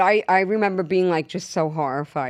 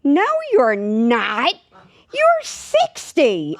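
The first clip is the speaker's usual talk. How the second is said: slow, much higher, mocking the agent's insistence; insistent agent; 6 semitones higher, 5 dB louder, much slower than usual. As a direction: drag it out, high and sure of herself. Agent insists she is sixty.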